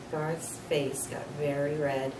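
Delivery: plain reading voice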